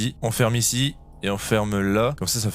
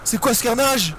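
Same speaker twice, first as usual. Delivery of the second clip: high alarmed shouting; frantic disbelief; panicked; 11 semitones higher than usual. Real, not acted. Overwhelmed by monsters.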